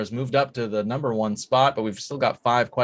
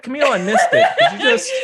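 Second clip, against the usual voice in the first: laughing; 13 semitones higher, 8 dB louder, faster than usual. Real, not acted. Laughing while asking repeat.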